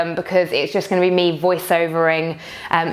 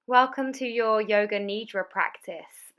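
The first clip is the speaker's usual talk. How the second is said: soft, calm meditation welcome, much softer; hushed yoga teacher voiceover greeting; guided meditation; 9 dB quieter than usual. Acted. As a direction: open the practice gently and quietly. Voiceover opening of the guided practice.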